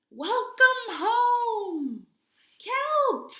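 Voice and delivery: high, loud, slow welcome call; whole crowd cheering welcome; joyful shout. Acted. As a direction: call out happily across the sea. Narwhals greet Kelp.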